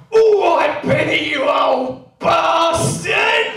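loud high shouted terrace greeting; bellowed reply. Retold or imitated. Football mate shouting a greeting back across the rows. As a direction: roar it back across the stand. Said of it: "replies like this".

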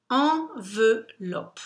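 "Enveloppe" split slowly into three separate syllables; "Enveloppe" very slowly, beat by beat. Shown as a demonstration. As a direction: count out "Enveloppe" with a pause after each syllable. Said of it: "je compte les syllabes".

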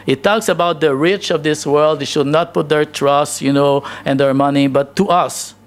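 normal talking voice

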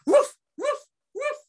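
high, loud dog barks; yapping dog. Acted. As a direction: bark like a small excited dog.

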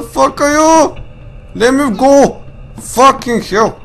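high, loud frantic panic; cornered and desperate; panicked. Real, not acted. Surrounded by monsters, trapped.

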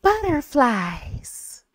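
very slow, high, delicate imitation; imitated airy poem-reading voice; very soft voice. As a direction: float the word out, long and soft. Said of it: "her voice was just so soft".

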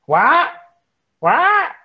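high, sharp confused "What? What?"; irritated old man. Retold or imitated. Retelling how the director snapped at the interviewer, high confused. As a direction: play the baffled old director snapping back. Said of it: "went from being, like, really polite to just going".

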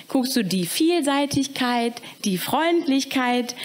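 slow, drawn-out examples; slow explaining. Acted. Alan teaching the caretaker, slow and patient. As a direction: spell out die Vielseitigkeit patiently.

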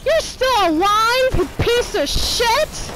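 very high, loud, drawn-out yelling; outraged scream. Real, not acted. Player raging at an enemy still alive, outraged scream. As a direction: scream every word slowly in outrage.

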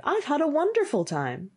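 high, enthusiastic storybook voice; delighted youth. Acted. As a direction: gush about it with pitch lifted. Youth boasts of his night.

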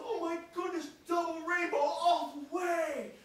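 high, loud ecstatic gushing; ecstatic hiker. Retold or imitated. Man filming a double rainbow, ecstatic gushing. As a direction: gush like a man overwhelmed by a rainbow. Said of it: "And he talked like this".

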